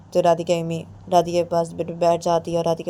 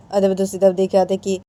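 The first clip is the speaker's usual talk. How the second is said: usual speaking voice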